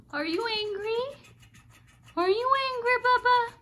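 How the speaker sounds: high sing-song pet talk with gasps. Real, not acted. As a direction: coo at him sweetly to make up. Baby talk to pet bird.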